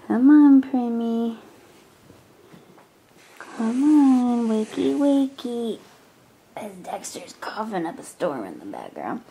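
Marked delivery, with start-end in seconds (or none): 0.1-5.8 singsong coaxing. Real, not acted. Mom to sleeping child, wakey wakey, singsong coaxing. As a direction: coax a little one awake, stretching the words.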